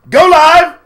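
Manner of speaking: high, very loud shout; shouted announcement. Pretend. Host kicking off the live show, shouted. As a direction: yell it out suddenly. Calling out start of the stream.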